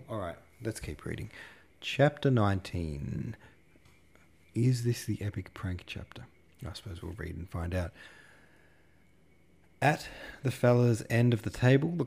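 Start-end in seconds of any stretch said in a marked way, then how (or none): 4.5-7.9 hushed aside, all breath; conspiratorial whisper. Real, not acted. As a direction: whisper it to the audience like a secret. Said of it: "whispered aside to listeners".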